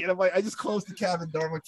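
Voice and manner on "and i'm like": plain reading voice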